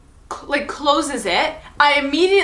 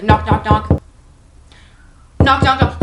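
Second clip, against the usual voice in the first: knocks; 6 dB louder than usual. Retold or imitated. Imitating the door knocks.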